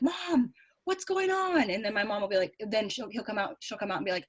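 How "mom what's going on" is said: higher-pitched complaining daughter; daughter calling Mom. Retold or imitated. Daughter asks her mom what is happening, high-pitched call. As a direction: imitate a kid calling out to Mom. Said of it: "my daughter will be like".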